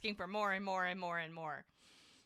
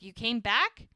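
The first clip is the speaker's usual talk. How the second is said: higher, louder startled question; mock shock; 4 semitones higher, 9 dB louder than usual. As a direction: act out surprise, rising sharply at the end. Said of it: "shocked that she's even there".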